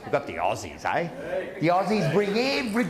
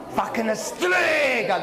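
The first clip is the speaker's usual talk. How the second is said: mock yell; 4 semitones higher, slower than usual. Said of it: "That's what he would say to her".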